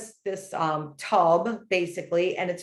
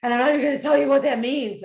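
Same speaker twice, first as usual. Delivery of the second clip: higher, louder, alarmed quoting of her friend; friend's alarmed retelling; 6 semitones higher, 6 dB louder, faster than usual. Retold or imitated. Quoting her friend about the bear dream, higher and louder. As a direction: play the friend all worked up. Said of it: "And she's like".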